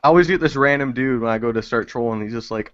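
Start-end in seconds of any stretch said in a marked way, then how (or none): none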